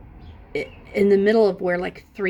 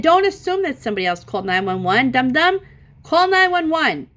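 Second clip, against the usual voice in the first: retold parent's urgent order, building to a raised voice; exasperated scolding; 7 semitones higher, 4 dB louder than usual. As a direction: scold with pitch climbing, ending high and loud. Scolding daughter to call 911.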